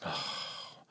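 imitated weary sigh, low and airy; big sigh. Dishevelled lady arriving at the bar, imitated big sigh. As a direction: let out a big unvoiced sigh. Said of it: "let out a big sigh".

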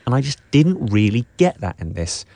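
ordinary delivery

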